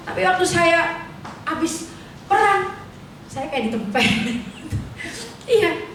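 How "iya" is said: usual speaking voice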